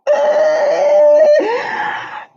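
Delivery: big high cackle of a laugh; loud laughing burst. Real, not acted. Watching the parliament clip, a real burst of laughter. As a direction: explode into laughter.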